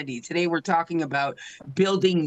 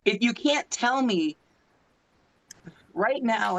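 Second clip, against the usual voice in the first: ordinary talk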